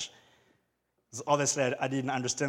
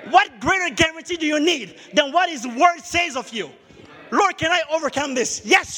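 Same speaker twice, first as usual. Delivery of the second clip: loud, much higher, shouted sermon climax; preaching at full force; 11 semitones higher, 7 dB louder than usual. Acted. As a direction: drive it home to the congregation.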